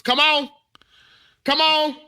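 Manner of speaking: high, pleading call to hurry; retold urgent calling. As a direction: urge the ambulance on, acted out. Retelling calls to the ambulance.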